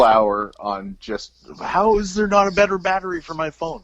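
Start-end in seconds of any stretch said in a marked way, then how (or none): 1.6-3.8 angry rant. Pretend. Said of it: "angrily ranting into my phone".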